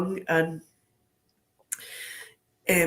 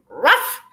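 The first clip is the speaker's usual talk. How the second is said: fake dog bark; 3 semitones higher, 8 dB louder than usual. Fake bark to startle policeman.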